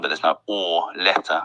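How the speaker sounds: usual speaking voice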